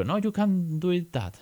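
high, slow, firm correction; correcting his son. Retold or imitated. Father correcting his son, slow and high. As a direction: spell out the can't for the child. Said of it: "muchas veces le digo".

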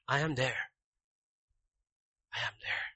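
gentle divine whisper; soft gentle voice of God. Acted. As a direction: say it almost as a hush. God's voice said softly.

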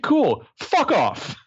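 mocking the counsellor's brush-off; dismissive Mona. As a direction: brush him off in a high, snotty voice. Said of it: "And she's like".

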